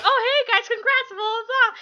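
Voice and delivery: high, bright imitation; bubbly mock greeting. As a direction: breeze in like a friendly star. Voicing Tom Hanks cheerfully.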